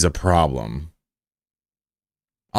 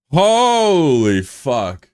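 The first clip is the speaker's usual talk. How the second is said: slow, drawn-out, higher and louder; stunned holy fuck; 7 semitones higher, 3 dB louder, much slower than usual. Real, not acted. Streamer stunned after a viewer reveals being sixteen, slow drawn-out disbelief. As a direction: stretch it out in disbelief.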